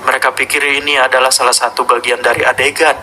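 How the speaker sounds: a sinister Godzilla speaking from the tape; monster voice. Acted. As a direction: growl out the kaiju's confession. Hidden message from the monster.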